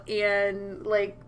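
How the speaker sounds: ordinary talk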